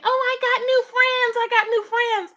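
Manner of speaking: high, drawn-out giddy mimicry; mock someone gushing giddily in a high voice. Imitating her bragging about new friends, giddy. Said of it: "she was over there all giddy saying".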